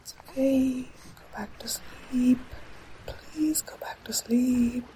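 put-on slow, gentle whisper to a child; soothing whisper. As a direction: lull her back to sleep. Soothing child back to sleep.

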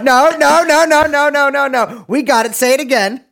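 high-pitched rapid string of protests; frantic protest. Real, not acted. Frantic demand to redo the intro.

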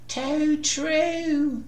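meek agreement from a nervous squire; timid squire. A narrator's reading. Squire agrees with his master.

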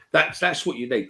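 usual speaking voice

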